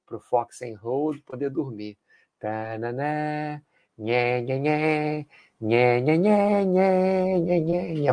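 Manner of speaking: soft lullaby murmur for sleeping; speaking softly. Pretend. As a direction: hush it like putting someone to sleep. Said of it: "vou falar baixinho agora". (falando baixinho)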